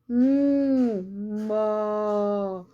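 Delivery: long drawn-out moo; sustained demonstration moo; cow moo imitation. Shown as a demonstration. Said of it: "What does cow do?".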